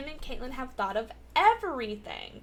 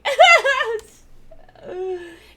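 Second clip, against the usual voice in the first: loud wheezing laugh; 11 semitones higher, 14 dB louder than usual. Real, not acted. Cracks up at own joke.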